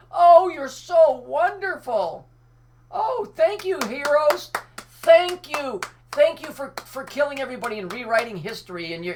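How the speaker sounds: high, loud mock-grateful gushing; sarcastic gratitude. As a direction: fawn over them in bitter mockery. Sarcastic thanks continued.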